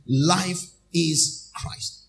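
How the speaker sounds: very slow, stretched words; slow refrain. Acted. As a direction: savour each word.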